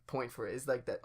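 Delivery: ordinary delivery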